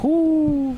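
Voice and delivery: high falsetto exhale of relief; a hammed-up whoo of relief; high relieved whoo. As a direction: let out a high, loud whoo. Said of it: "And I'm like".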